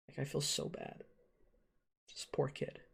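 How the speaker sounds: barely breathed, softly sorry; hushed whisper. Real, not acted. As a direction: whisper it out of sympathy. Feeling bad for Kakashi.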